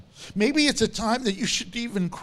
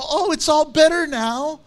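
mock cheerful; 5 semitones higher, 3 dB louder than usual. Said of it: "don't you go".

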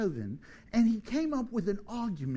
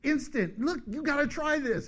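Dudley Moore joking; 4 semitones higher than usual. Retold or imitated. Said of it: "he goes".

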